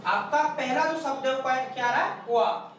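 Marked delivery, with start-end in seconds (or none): none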